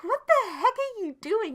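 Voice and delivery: high, loud shocked yell; startled shout. Retold or imitated. Startled by husband crawling in.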